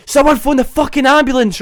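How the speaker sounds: high, loud re-enacted cry for help; retold panicked shout. Retelling him shouting from the bathroom for an ambulance, panicked. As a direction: yell it out urgently, re-enacting the panic. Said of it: "sitting shouting about someone phone an ambulance".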